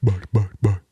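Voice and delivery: high greeting sliding back down; babbled puppy greeting, high then settling; friend's baby-talk voice. Retold or imitated. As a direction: imitate a man cooing to a dog. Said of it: "He starts talking like this".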